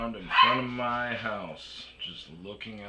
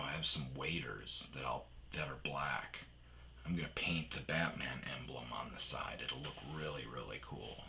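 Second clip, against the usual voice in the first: whispering; 6 semitones lower, 14 dB quieter than usual. Retold or imitated. Whispered retold reply.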